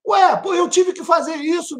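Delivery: high shouted insistence; emphatic shout. Real, not acted. Pregador enfatiza o testemunho. (grito enfático e agudo)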